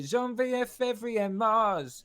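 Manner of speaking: put-on high, loud Cockney-accented French; Del Boy. Singing the birthday song like the TV character, Cockney French. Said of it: "he keeps his accent".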